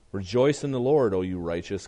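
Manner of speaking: ordinary talk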